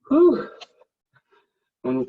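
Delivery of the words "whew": high blowing exhale; loud whew. Real, not acted. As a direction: blow out a big whew. Tough workout announced, loud exhale.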